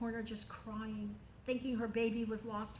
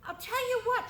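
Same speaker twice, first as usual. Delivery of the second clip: princess voice; 11 semitones higher, 4 dB louder than usual. Acted. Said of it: "she says to Jochebed".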